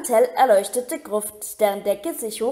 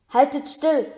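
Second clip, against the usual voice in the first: firm order, slightly raised; commanding; 3 semitones higher, 3 dB louder than usual. Acted. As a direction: bark a command to stay still. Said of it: "befahl ihnen Hermine".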